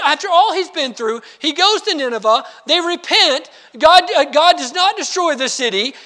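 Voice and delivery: ordinary delivery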